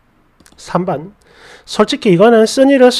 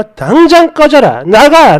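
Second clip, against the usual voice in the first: acted outburst, higher and rising in volume; angry order; 4 semitones higher, 4 dB louder, slower than usual. Woman ordering her boyfriend out, acted anger. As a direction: throw someone out, drawing out the first command. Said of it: "주체할 수 없는 화가 치밀어 올랐어요".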